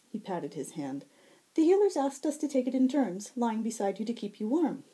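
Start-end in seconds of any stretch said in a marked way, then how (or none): 1.6-4.8 high, ringing, lilting fae voice; bright high herald. Acted. Fae herald greeting the wounded Zack, high and bright. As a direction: sing it out high and sweet.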